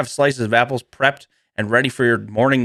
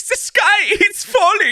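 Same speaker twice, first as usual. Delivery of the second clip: high, laughing mock panic; high cartoon squeak; Chicken Little voice. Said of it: "he's like".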